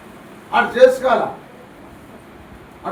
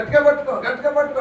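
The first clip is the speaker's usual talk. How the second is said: parent's warning; 6 semitones higher, faster than usual. Retold or imitated. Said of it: "మేము అంటున్నాం".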